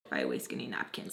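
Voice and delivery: hushed, voiceless whisper; whispered aside. Acted. Telling what she thought while cleaning up, whispered. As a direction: drop right down into a whisper. Muttered defiance retold from her head.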